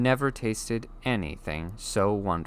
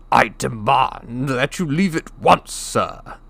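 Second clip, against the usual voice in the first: slow, louder, stiff blustering uncle; pompous demand; 5 semitones higher, 6 dB louder, slower than usual. Acted. Uncle Vernon demands the giant leave, blustering. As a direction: try to sound in charge while scared.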